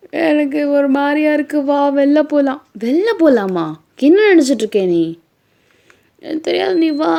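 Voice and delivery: high, loud, pouting insistence; whiny demand. Acted. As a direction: nag him like an upset wife. Acted wife nagging to go out.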